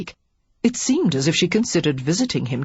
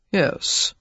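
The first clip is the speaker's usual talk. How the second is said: sardonic. A narrator's reading. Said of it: "Alex said sardonically".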